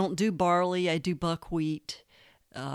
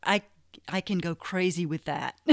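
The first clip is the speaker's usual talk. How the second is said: normal talking voice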